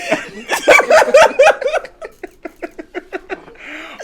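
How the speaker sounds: very high, loud cackling laughter; long loud laugh. Real, not acted. Man teased by the panel, long loud laugh. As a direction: crack up helplessly.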